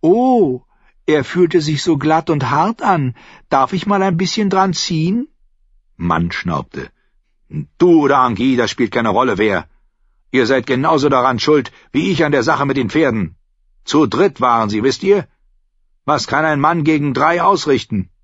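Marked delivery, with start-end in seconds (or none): 0.0-5.3 high, cooing mock girl voice; imitating a smitten girl. Singer mimicking a girl admiring the archer, teasing. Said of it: "habe ich sie ihn fragen gehört". 7.8-18.1 higher, raised-voice retort; indignant innkeeper. A narrator's reading. Innkeeper excusing himself about the horses, indignant.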